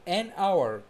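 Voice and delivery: slow an hour, silent h, said en awer; careful pronunciation. Shown as a demonstration. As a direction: stretch out an hour with no h sound. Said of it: "ركز معايا كده في النطق". (نطق an hour ببطء ومن غير صوت الـ h)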